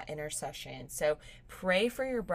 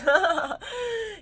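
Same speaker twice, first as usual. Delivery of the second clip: loud high laugh breaking into crying; tearful laugh; 10 dB louder than usual. Real, not acted. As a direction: laugh through sudden tears. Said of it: "I'm about to tear up".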